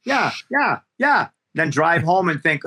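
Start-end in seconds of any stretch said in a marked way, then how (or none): 0.0-1.3 higher, eager yes-man agreeing to everything; high, eager mock yes-man; mock people-pleaser. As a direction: play the meek yes-man. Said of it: "I used to go in and go".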